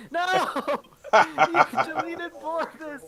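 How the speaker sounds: high, loud laughing shout; laughing protest. Real, not acted. Player protesting to the DM that a character got deleted, laughing shout.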